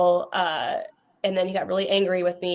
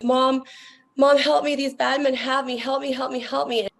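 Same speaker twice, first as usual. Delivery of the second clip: imitating a daughter's high-pitched plea for help; high, urgent begging, retelling a child's cry; retold sobbing plea; 6 semitones higher than usual. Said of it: "crying and sobbing, saying".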